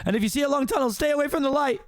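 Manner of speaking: very high, urgent advice; worried donkey. Acted. Donkey warning his dying friend, high and urgent. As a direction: call back anxiously in a high voice.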